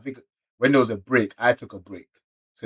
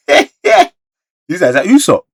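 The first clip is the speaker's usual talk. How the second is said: laughing, much higher and louder; excited surprise; 8 semitones higher, 14 dB louder than usual. Real, not acted. Usopp shows up to protect, laughing excited shout. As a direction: burst out in thrilled surprise. Sudden character appears.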